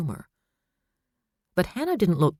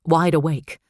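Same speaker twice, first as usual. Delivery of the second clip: ordinary delivery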